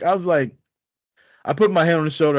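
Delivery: ordinary talk, nothing marked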